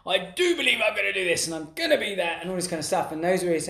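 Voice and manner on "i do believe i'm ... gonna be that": lightly voiced fired-up guru; a hint of a pumped-up speaker; Tony Robbins affirmation. Imitating Tony Robbins on stage, mildly pumped. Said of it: "and he'd be like".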